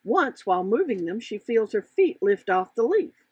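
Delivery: ordinary delivery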